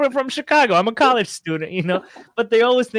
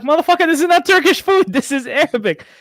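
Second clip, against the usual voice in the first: higher, louder indignant retort; indignant shout; 9 semitones higher, 5 dB louder than usual. Retold or imitated. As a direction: snap back, offended. Retorting to Turkish customer.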